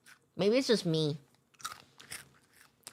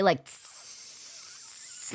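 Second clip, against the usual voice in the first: spray hiss; 3 semitones lower, 9 dB quieter than usual. Retold or imitated. Imitating spraying at kids.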